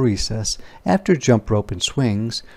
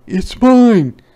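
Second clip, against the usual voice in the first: high, loud demand; demanding boy; 11 semitones higher, 9 dB louder than usual. Acted. Martin loudly demands the camera from Ruthie. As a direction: grab for it with your voice. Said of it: "said Martin".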